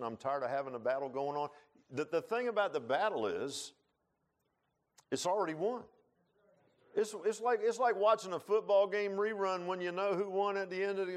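high, loud preacher at full force; fiery preaching. Acted. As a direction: preach it out with rising fire.

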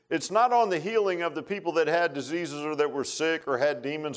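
a preacher's rising sermon voice, building in force; preaching. Acted. Pastor to his church on Sunday, preaching with conviction. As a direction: preach it out to the pews, with growing fire.

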